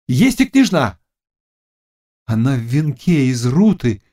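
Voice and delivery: high seer's voice, rising to a cry; witch in trance. A narrator's reading. Witch seeing the princess for the Cossack, entranced. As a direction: announce each sight high, building to the last. Witch figure telling vision.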